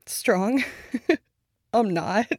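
a loud, bitter laugh at the word strong; bitter laughing denial. Acted. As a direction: scoff and laugh it off. Bitter laugh at being called strong.

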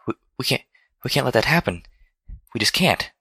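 high, halting little plea; fearful pleading. A narrator's reading. Fluttershy begging that war not happen, fearful pleading. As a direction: stammer it out meekly. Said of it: "Fluttershy was trembling just at the thought".

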